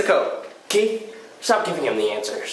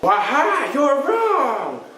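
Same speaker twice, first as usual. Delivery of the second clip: gloating; 13 semitones higher, 4 dB louder, much slower than usual. Pretend. Quizmaster gloats.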